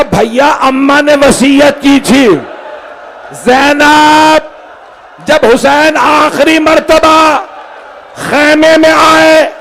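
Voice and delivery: high, slow, rising mourning shout; keening recitation of the mother's will; wailing lament. Acted.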